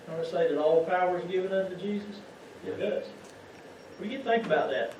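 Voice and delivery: usual speaking voice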